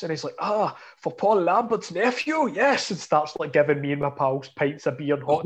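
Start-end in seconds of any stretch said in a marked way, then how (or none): 0.4-2.9 high, drawn-out mimicked delight; delighted fan. German fan welcoming Paul Lambert's nephew, mock delight. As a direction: imitate a thrilled German fan.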